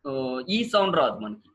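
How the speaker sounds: normal talking voice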